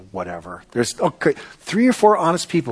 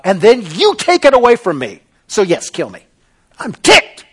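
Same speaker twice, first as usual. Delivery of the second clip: loud, indignant complaint to God; hammed-up ticked-off rant; angry Jonah; 3 semitones higher, 4 dB louder than usual.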